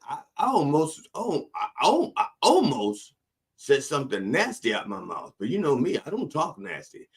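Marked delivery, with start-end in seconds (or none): none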